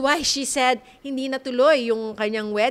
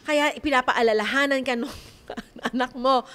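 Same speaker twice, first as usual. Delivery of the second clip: ordinary talk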